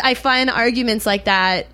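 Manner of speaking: ordinary delivery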